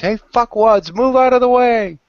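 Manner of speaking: high, loud hollered warning; top-of-lungs scream. Acted. Man yelling at people on the trolley track, full-throated scream. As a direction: bellow it to clear the track. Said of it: "scream at the top of your lungs".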